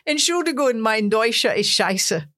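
earnest attempt at a German accent; best German accent. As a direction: put on your best German accent for a big crowd. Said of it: "in my, my best German accent".

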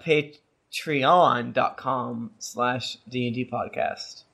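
very slow, each word separated for the listener; spelled out slowly. Shown as a demonstration. Host repeating the web address very slowly for cohosts. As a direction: drag it out so nobody misses it. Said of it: "Could you repeat it one more time?".

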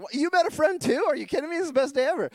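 high, excited gush; thrilled surprise. Retold or imitated. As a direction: sound like it's the best day ever. Thrilled at meeting another couple.

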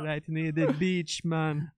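laid-back Jamaican drawl; jamaican accent. Retold or imitated. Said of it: "I can do my Jamaican accent".

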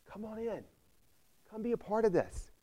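high, soft coaxing plea; a father begging his older son softly to come in, high and soft; pleading father. Retold or imitated. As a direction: coax the son gently inside. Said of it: "and pleads".

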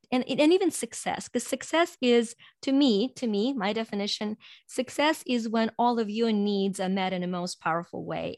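ordinary talk

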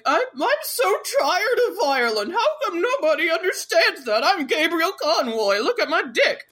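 high, whiny mock self-pity; Gabriel Conroy. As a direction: wail it melodramatically to mock a self-important man. Mocking Gabriel's self-pitying speech.

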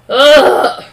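loud high ugh of disgust; sharp disgusted groan. Real, not acted. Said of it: "I hate how she's manipulating".

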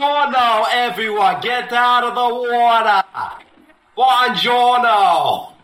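put-on high, hollered Boston-Italian accent; Mark Wahlberg. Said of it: "what would Italian Mark Wahlberg sound like".

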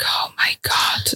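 slow, breathless whisper; shocked whisper. Retold or imitated. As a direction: draw it out in a stunned whisper. Walking to other scan room.

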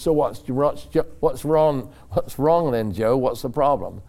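brisk questioning director. Retold or imitated. Director quizzing shaken driver, retold.

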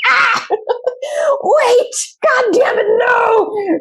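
high, loud frustrated outburst with laughter; hammed-up exasperated shriek; mock scream. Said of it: "I wanna, like, scream up and down".

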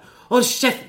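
loud, high yell; furious yell, replayed at full force; retold shout. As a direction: bark it at the bullies. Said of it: "skriker så här".

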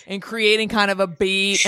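ordinary talk

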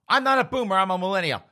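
loud, high outraged yell; indignant protest. Retold or imitated. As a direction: play an insulted dad shouting back. Father insulted as boomer, protests.

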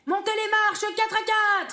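ordre crié haut et lent; adjudant-chef. Acted. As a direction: aboyer l'ordre comme un sergent. Ordres militaires. (adjudant qui crie)

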